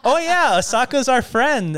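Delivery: bright eager imitation of the girls; imitating the girls. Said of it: "And they're like".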